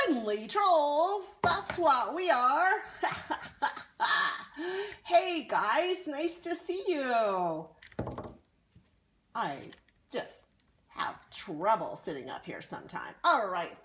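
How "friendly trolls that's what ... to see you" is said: high, excited troll voice, loud; a cheerful little troll greeting guests; friendly troll. Acted.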